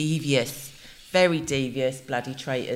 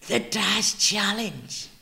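slow, drawn-out advert echo; hammed-up, lingering slogan delivery; advert catchphrase; 4 semitones higher, much slower than usual. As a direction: draw it out like a TV ad slogan.

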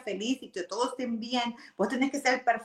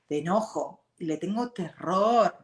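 ordinary delivery

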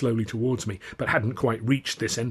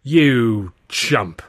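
loud exasperated insult; drawn-out, loud telling-off; scolding; 7 dB louder than usual. A narrator's reading. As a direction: snap it at a blundering fool. Narrator scolds Hurley in quarrel.

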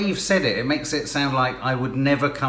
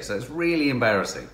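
ordinary talk, nothing marked

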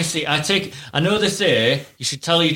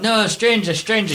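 higher, restrained mock shout; demonstrated shout; 6 semitones higher than usual. Shown as a demonstration. Dad coaching his daughter against strangers, restrained mock shout. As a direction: show a child how to call out. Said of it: "You shout".

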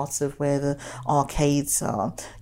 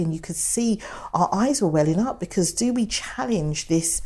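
normal talking voice

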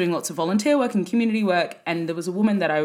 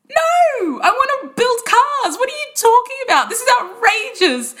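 much higher, indignant protest; mock outraged refusal; 5 dB louder than usual. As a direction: play up your disbelief at the offer. Retelling refusing book offers.

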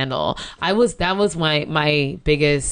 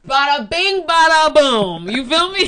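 put-on Jersey wiseguy accent, louder and higher; 8 semitones higher, 5 dB louder, slower than usual. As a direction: throw it out like a Jersey mobster. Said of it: "This is Jersey".

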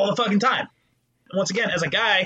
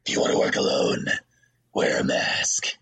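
Batman quote. Retold or imitated. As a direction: growl it low like Batman. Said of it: "like Batman says in The Dark Knight Rises".

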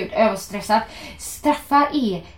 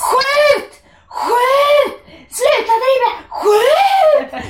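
high, loud frantic shouts; screaming at the match; 7 dB louder, much slower than usual. Retold or imitated. Fan re-enacting yelling at Sweden's players, screaming. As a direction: yell it at the players on TV. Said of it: "jag satt och skrek".

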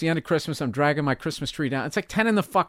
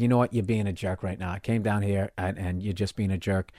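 lower, quieter firm rebuke; calm and low; 8 semitones lower, 7 dB quieter than usual. Retold or imitated. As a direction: say it flat and restrained. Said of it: "I just said".

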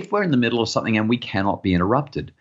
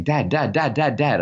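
put-on pestering child; 5 semitones higher than usual. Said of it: "she's tapping my leg, saying".

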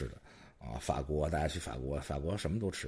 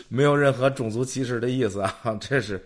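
louder and higher, laughing through it; laughing disclaimer; 6 semitones higher, 8 dB louder than usual. Real, not acted. Host laughing after the punchline of his own joke. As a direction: still cracking up while insisting it's harmless.

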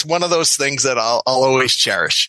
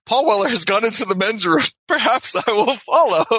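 higher voice, laughing through the words; half-laughing inner voice, pitched up; giggly scheming; 6 semitones higher than usual. Retold or imitated. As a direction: giggle as you plot it. Scheming to follow star into restroom.

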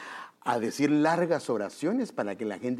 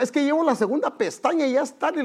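wife stalling while getting ready; 12 semitones higher, 5 dB louder than usual. Retold or imitated. Wife still getting ready, late.

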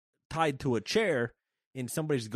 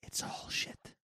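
conspiratorial whisper; hushed aside; secret whisper; 11 dB quieter, slower than usual. Acted. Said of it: "And secretly".

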